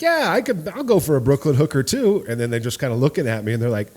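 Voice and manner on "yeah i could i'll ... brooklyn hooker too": loud, high, playful agreement; good mood. Retold or imitated. Bartender in a good mood joking with costumed customers, upbeat. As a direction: play along brightly with raised pitch. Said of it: "I was in a good mood".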